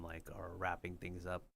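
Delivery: usual speaking voice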